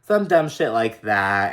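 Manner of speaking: ordinary talk, nothing marked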